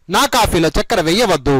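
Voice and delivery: normal talking voice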